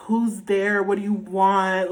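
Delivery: high, hammed-up plea, near tears; dramatic. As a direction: demand it as if about to cry. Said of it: "Like, I'm so dramatic".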